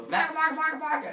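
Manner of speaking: loud, high gibbering monsters; monster babble. Retold or imitated. Monsters answering Heracles, loud high gibberish. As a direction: gabble it like cartoon beasts.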